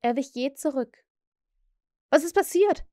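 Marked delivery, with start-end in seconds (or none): 2.1-2.8 put-on higher, louder alarmed boy; a boy's shocked cry, higher than before; frightened cry. A narrator's reading. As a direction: cry out backing away. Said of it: "rief er noch einmal".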